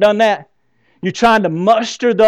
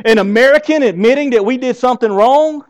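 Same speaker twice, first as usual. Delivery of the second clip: said again louder and higher for emphasis; louder repeat; 6 semitones higher, 5 dB louder than usual. Shown as a demonstration. Preacher repeating a line louder to wake the congregation. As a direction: punch the line harder the second time. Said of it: "I'm gonna say that again".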